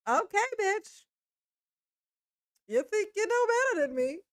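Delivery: high-pitched pretend challenge; mock sassy retort. Voicing man's imagined sassy reply.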